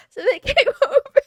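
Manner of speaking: words broken by laughter, high and loud; laughing. Real, not acted. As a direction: laugh through it. Laughing at own story.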